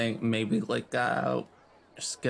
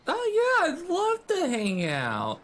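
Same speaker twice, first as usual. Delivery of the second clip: much higher, bright eager yes; eager high greeting. Acted. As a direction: gush at the dad, pitched up. Player greets Dad in character.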